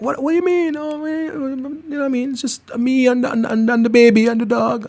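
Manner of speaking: high, soft pleading friend voice; mock whiny caller begging to bring the dog; imitating caller. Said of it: "They're like".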